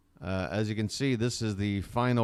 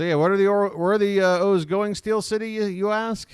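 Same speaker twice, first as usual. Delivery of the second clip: hyped-up question; 10 semitones higher, 7 dB louder than usual. Acted. Reading a fan's question aloud.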